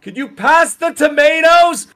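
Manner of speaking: high, slow mock scream; kitchen screaming. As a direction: holler it over roaring fans, drawn out. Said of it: "we're still screaming like that".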